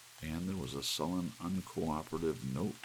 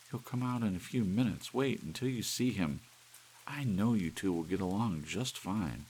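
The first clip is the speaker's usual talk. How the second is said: higher and brighter, eager to show off; a young wife's eager promise; Doris excited; 7 semitones higher, 6 dB louder than usual. Acted.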